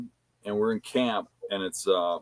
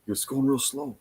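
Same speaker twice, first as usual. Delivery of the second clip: lightly imitated lookout, softer and higher; narrating play-by-play; 5 semitones higher, 5 dB quieter than usual. Hunter to camp as truck creeps past, lightly imitated narrating. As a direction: imitate a buddy reporting each move. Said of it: "like, narrating it".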